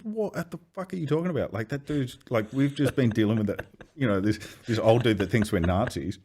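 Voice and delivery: high, shocked disbelief; can't believe what he's hearing; disbelieving outburst. Retold or imitated. Demanding answers from his laughing offsider, high disbelief. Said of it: "I said".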